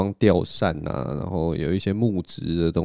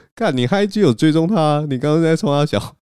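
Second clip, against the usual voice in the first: higher, louder teasing accusation; gleeful gotcha; 8 semitones higher, 4 dB louder than usual. Retold or imitated. Friend catching the speaker out, teasing gotcha. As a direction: pounce on him, you caught him. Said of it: "他就转过来说".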